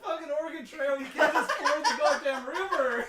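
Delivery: loud, laughing outburst; laughing yell. Real, not acted. Player yells laughing at GM.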